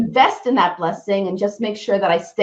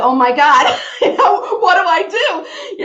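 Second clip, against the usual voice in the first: high, laughing mock panic; 9 semitones higher, 3 dB louder than usual. Real, not acted. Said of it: "I was like".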